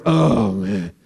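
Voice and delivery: low, deflated oh man; disappointed groan. Retold or imitated. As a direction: let the air out of it, let down. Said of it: "Some of you would be like".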